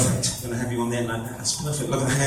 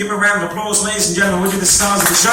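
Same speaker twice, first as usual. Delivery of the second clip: louder compère call to the crowd; showman announcement; 4 semitones higher, 5 dB louder than usual. Acted. Hypnotist hyping the crowd, loud announcer. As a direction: sell the applause.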